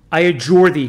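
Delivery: loud solemn cry of command; priest crying out. Acted. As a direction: call it out with full authority. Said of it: "cried the priest once more".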